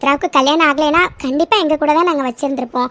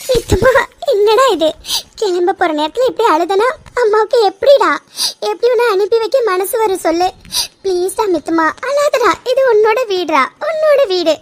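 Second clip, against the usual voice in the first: high, emotional plea to stop crying; upset, high-pitched consoling; distressed pleading; 6 semitones higher than usual. Acted. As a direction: plead with a tearful loved one, pitch raised. Consoling a tearful Mithu at parting.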